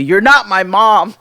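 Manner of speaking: mock defiant shout; retold yell. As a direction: call it down the hallway like a defiant boy. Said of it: "yells down the hallway to me".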